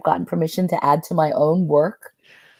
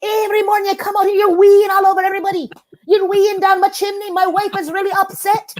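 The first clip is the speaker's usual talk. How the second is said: little man voice; 13 semitones higher, 4 dB louder than usual. Retold or imitated. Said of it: "And there's a wee little man".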